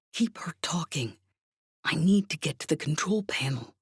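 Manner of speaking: whispered instruction over an earpiece; hushed urgent aside. A narrator's reading. Covert message through an earpiece.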